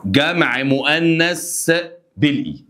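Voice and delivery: slow dictation. Shown as a demonstration. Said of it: "teacher dictates rules".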